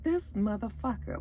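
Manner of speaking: under-breath mutter. Retold or imitated. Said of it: "Marlo was just sort of looking like".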